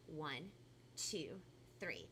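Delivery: ordinary delivery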